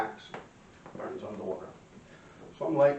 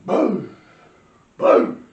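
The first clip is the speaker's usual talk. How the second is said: loud booms; explosion booms; 9 semitones higher, 13 dB louder than usual. Retold or imitated. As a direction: shout each boom like a firecracker. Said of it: "she's going".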